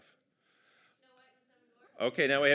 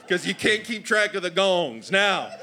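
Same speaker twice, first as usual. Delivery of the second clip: higher, impatient Southern dad voice; exasperated Southern father; 8 semitones higher, 6 dB louder than usual. Acted. Father explains the gongs to forgetful son, exasperated Southern voice. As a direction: explain it wearily in a Southern accent.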